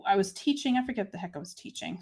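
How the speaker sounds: normal talking voice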